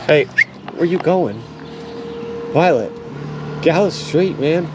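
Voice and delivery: loud, high call to the dog. Real, not acted. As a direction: call her back out of the street. Calling dog from street.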